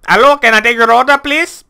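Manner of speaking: high, loud, fast put-on Indian accent. Game server player mocking strangers in an Indian accent. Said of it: "used an Indian accent that sounded something like this".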